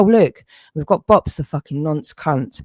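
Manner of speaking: ordinary talk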